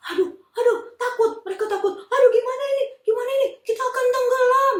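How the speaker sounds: high, loud frightened cries; panicked wail. Acted. Disciples in the rocking boat, panicked high wailing. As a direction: fear of drowning in the storm.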